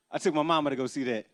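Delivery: ordinary talk, nothing marked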